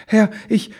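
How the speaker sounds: high, loud halting stammer; frightened stammer. A narrator's reading. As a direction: falter in fear before the mighty one. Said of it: "stotterte Ribbelwitsch". (ängstlich stotternd, mit hoher Stimme)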